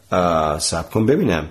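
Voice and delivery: low, pondering hesitation; thoughtful. A narrator's reading. Tompkins stops to rethink his theory, thoughtful. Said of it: "به فکر فرو رفت".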